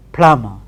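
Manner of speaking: "plumber" as plum-a; "plumber" spoken with b dropped; silent b plumber. Shown as a demonstration. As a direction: say "plumber" without the b. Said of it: "It is".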